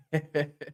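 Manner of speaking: soft, brief laugh; short soft chuckle. Real, not acted. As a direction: chuckle quietly to yourself. Laughing at the live chat.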